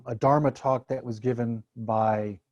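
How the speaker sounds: normal talking voice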